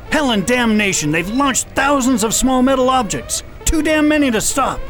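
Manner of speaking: alarmed commander seeing thousands of incoming objects. Acted. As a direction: sound the alarm as it overwhelms you. Swarm of objects launched.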